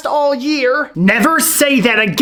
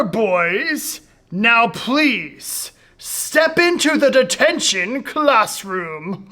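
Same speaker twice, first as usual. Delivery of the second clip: ordinary delivery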